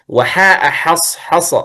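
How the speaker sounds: حص حص said slowly with a light, thin ح, no heaviness; thin ha demo. Shown as a demonstration. Teacher slowly demonstrates thin حص to students. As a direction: stretch out حص حص, keeping the ح unthickened. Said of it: "أي ترقق الحاء دائمًا".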